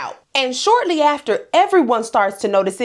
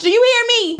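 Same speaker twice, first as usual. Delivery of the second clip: high, insistent rhetorical question; emphatic; 12 semitones higher than usual. Real, not acted. Host stressing how packed the church was, high and insistent. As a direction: demand the viewers' agreement. Bragging about packed family funerals.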